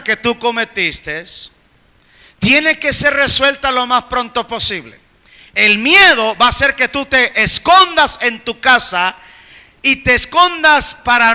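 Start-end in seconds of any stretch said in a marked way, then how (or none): none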